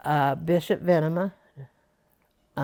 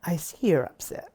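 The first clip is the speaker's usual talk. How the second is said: small whispered voice. Retold or imitated. Woman recalling God's small voice speaking to her, whispered. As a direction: breathe it like a still small voice. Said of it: "I heard this small voice".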